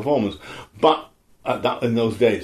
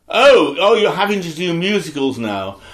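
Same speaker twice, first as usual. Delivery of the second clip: mock condescension; 4 semitones higher, 5 dB louder than usual. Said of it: "People said to me".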